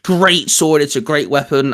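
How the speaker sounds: usual speaking voice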